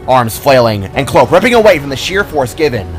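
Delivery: ordinary delivery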